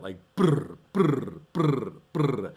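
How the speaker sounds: buzzing brr, like a stuttering kick drum. Retold or imitated. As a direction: mimic a granular kick with your lips. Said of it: "that sound where it's a kick".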